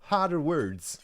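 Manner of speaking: high, lilting mock Irish; loud, shaky attempt at Irish; attempted Irish accent. Shown as a demonstration. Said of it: "I could try a bit of Irish".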